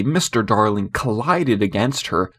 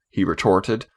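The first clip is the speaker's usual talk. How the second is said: ordinary delivery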